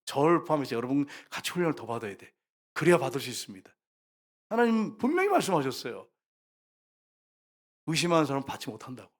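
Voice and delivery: normal talking voice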